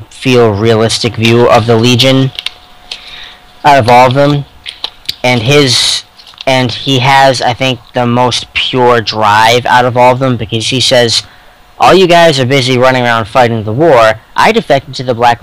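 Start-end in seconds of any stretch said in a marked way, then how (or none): none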